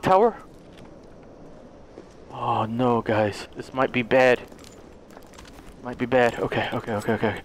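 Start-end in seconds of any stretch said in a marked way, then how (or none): 2.3-7.4 low, hushed worry; quiet, apprehensive undertone; uneasy foreboding. Real, not acted. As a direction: mutter it low and softly, bracing for trouble. Dreading what comes in the game.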